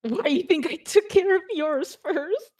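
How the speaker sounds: lightly acted, high-pitched character jab; in-character retort. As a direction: pipe up high with a teasing comeback. In-character retort, then disowned as not said.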